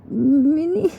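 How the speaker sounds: calling a name on the edge of tears; mildly acted tearful cry of a name. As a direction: let the name rise into tears. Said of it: "burst into tears".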